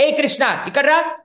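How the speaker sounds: loud, high call across the yard; mother summoning her son loudly. Acted. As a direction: call the boy over sharply. Mother calls child Krishna.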